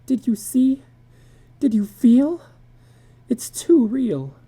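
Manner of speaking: high, pleading, shaken questions; frightened and tearful. A narrator's reading. Wife clinging to husband after the lion scare, frightened. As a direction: beg him to admit it felt real. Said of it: "cried steadily".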